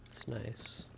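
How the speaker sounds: a soft unvoiced whisper to himself; whispered aside. Real, not acted. Streamer whispering to himself over found gold. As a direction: breathe it out as a private whisper.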